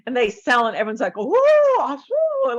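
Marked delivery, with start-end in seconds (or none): 1.2-2.5 very high, wowed ooh and ah; an audience cooing in admiration; impressed ooh. Retold or imitated. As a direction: swoon at something impressive. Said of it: "like, im-, impressive".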